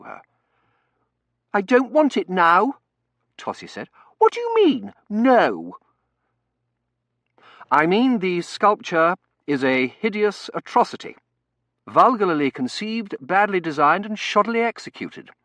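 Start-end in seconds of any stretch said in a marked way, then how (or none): 1.5-2.8 high, loud, petulant refusal. Acted. Tossie snaps at the servant. 4.2-5.8 very high, loud indignant challenge; affronted young lady, voice shooting up. Acted. Tossie indignant at being contradicted. 7.7-15.3 high, loud, emphatic verdict; forceful servant giving his opinion; blunt butler Bain. Acted.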